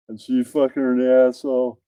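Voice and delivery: ordinary talk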